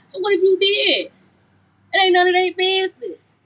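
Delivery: high, indignant "so what"; defiant outburst. Real, not acted. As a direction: toss it off indignantly.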